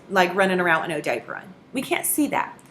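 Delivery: normal talking voice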